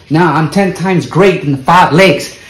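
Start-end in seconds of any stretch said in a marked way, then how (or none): none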